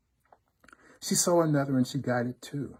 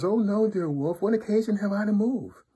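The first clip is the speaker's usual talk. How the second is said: put-on high, louder little-girl voice; innocent little daughter; 10 semitones higher, 5 dB louder than usual. A narrator's reading. Little daughter denying to the wolf that she moved, high innocent voice. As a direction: protest innocence in a high sing-song child voice. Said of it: "The little daughter says".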